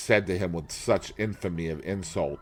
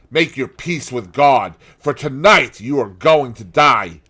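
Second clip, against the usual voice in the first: louder, higher, menacing threat; a brother swearing revenge, louder; vengeful sailor; 5 semitones higher, 7 dB louder than usual. A narrator's reading. James Vane's vengeful speech.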